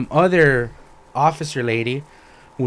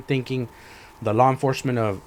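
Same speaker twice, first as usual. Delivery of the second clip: normal talking voice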